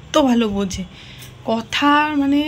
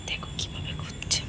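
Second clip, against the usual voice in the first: hushed whisper to the camera; 19 dB quieter than usual. Real, not acted. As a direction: whisper while the dog searches. Hiding, commentary to camera.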